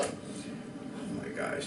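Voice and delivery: slow, breathless whisper; a whispered groan of despair; whispered dread. Retold or imitated. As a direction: hush it in dismay. Dread after outburst.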